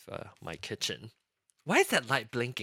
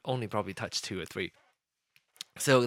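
usual speaking voice

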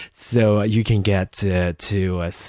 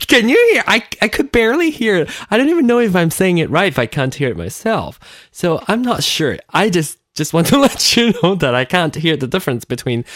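bubbly, higher, giggling; laughing aside; 7 semitones higher, 4 dB louder, faster than usual. Real, not acted. Telling listeners she can't hear it, giggly. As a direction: talk fast through giggles.

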